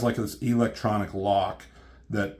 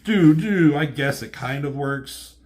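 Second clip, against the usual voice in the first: higher and louder, a hammed-up bro voice; mock dude reaction; 5 semitones higher, 6 dB louder than usual. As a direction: play a slacker friend shrugging it off.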